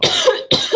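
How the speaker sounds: choking cough after a sip of water; real coughing fit from water going down the wrong way. Real, not acted. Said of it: "choked a little bit".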